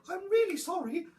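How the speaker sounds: high little dinosaur voice; small, high apologetic voice; apologetic youngster. A narrator's reading. Timid child dinosaur speaks in story.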